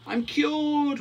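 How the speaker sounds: very high, loud joyful whoop; squealed celebration, drawn high. A narrator's reading. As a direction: whoop in triumph as a cured duck. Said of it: "whooped Quentin".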